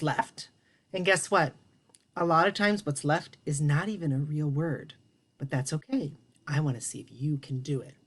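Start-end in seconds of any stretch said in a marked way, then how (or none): none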